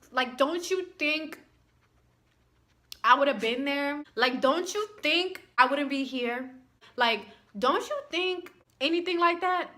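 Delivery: rising incredulous rant; exasperated. Real, not acted. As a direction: push each question harder in disbelief. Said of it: "exasperated rant".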